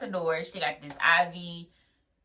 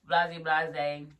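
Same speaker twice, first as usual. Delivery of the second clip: ordinary delivery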